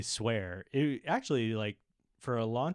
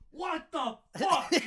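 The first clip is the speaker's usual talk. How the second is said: dad's outburst; slower than usual. Retold or imitated. Dad finds salt in coffee.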